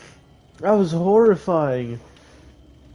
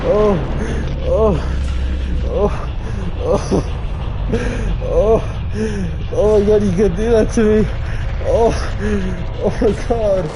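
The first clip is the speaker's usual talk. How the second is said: terrified screaming; 6 semitones higher than usual. Real, not acted. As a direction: scream in real terror. Screaming at sudden monster.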